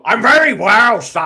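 put-on loud, high barked Irish accent; barking Irish accent. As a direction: bark it out in a hammed-up Irish accent. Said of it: "some sort of barking Irish accent".

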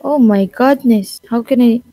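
ordinary delivery

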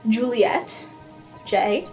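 ordinary talk

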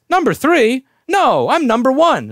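loud, high, affronted retort; mock indignation. Coworker insisting on her rank with him, mock indignant. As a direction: act out wounded pride at high pitch.

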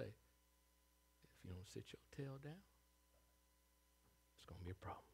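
low hushed threat, almost a whisper; quiet mama warning. Retold or imitated. Mother quietly warning her child to sit down. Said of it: "She wouldn't raise her voice".

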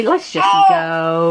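slow put-on baby voice. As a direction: drag it out like a toddler.

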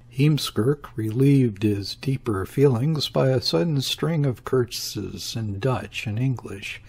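ordinary delivery

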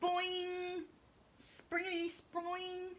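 high slow sproing noise; high springy boing. Acted. Jump sound effect.

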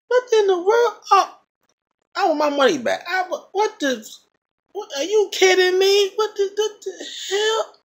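very high incredulous squeals; mock the stunned crowd demanding refunds; outraged disbelief. Theater crowd after the credits roll, high outraged disbelief. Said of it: "Every single person in that theater had the same reaction".

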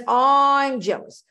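hammed-up self-declaration; mock ego claim. Shown as a demonstration. Teacher mocking the big I, loud and slow. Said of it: "then it's".

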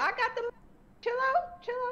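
high-pitched pleading to calm down; excited. Real, not acted. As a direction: squeal it over the crowd. Everyone talking over her.

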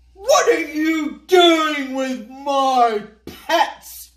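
slow, loud shouted demand; angry demand. Acted. Mister Ao Yang confronts Little Chow about his snakes, shouting. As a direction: bellow it at the crowd, outraged. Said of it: "he demanded".

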